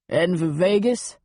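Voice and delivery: put-on casual New York-accented question; New York guy making small talk. A narrator's reading. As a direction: chat her up like a New Yorker. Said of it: "in a thick New York accent".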